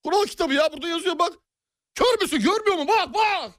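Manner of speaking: high exasperated shouting; mock rage. Clerk yells at the customer with the rulebook, high angry shout. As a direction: shove the rulebook at him, yelling.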